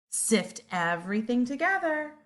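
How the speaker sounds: ordinary delivery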